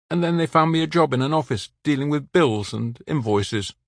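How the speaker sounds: recalling the orphanage; man with local French accent, sad. A narrator's reading. The man tells his past.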